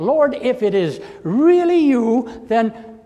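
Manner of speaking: high, loud, exultant call; triumphant cry. Retold or imitated. Peter calling to Jesus on the water, loud triumphant cry. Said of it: "in a triumphant spirit he cries out".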